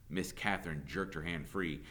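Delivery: ordinary delivery, nothing marked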